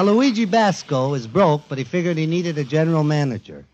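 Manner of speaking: ordinary talk, nothing marked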